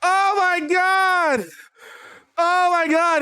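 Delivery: high, laughing exclamation; laughing disbelief. Real, not acted. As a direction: crack up at your own memory. Laughing at remembered joke.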